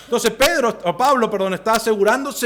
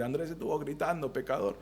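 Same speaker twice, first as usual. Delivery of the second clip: much softer, lower aside; imagined accusation; 3 semitones lower, 13 dB quieter than usual. Acted. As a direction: murmur it like a disapproving churchgoer. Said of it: "después me van a decir". (acusación fingida, en voz baja)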